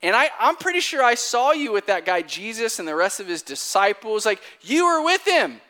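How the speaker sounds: loud, high, mock-insistent accusation; insistent servant girl. Servant girl challenges Peter a second time, loud insistent accusation. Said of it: "And she says".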